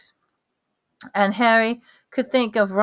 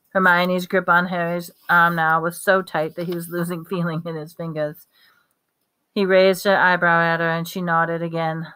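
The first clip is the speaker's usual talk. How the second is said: usual speaking voice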